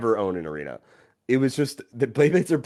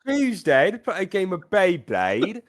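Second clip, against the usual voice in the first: bad British accent; 8 semitones higher than usual. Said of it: "a bad accent from a different country".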